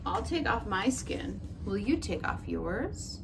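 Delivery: usual speaking voice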